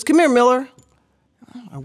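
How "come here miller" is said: mock coach call. Coach calling her over.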